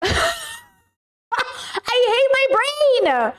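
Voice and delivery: high-pitched exasperated whine; frustrated wail. Real, not acted. Streamer to chat on blanking on a name, frustrated wail. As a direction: wail it high, falling on "brain".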